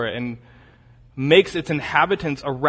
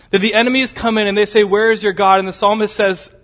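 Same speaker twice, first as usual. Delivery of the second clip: higher, louder, driving sermon voice; full-force preaching; 6 semitones higher, 6 dB louder, faster than usual. Acted. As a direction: preach it out with rising fire. Preacher voices the enemies' taunt.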